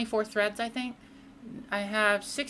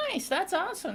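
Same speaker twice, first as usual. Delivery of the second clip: excited; 9 semitones higher than usual. Real, not acted. Reacting to a chatter's PC build.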